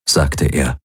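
usual speaking voice

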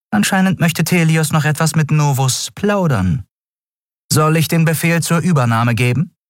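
high, dutiful reporting voice; eager-to-serve subordinate, pitched high; deferential servant. Acted. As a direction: answer the master respectfully. Servant answers the Schattenkaiser.

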